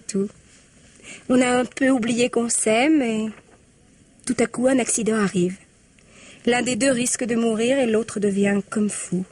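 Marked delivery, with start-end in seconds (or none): none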